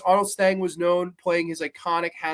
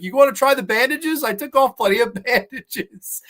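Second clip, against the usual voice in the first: mocking mummy; 6 semitones higher, 5 dB louder than usual. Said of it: "He's like".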